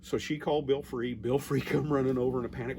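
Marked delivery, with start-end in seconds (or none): none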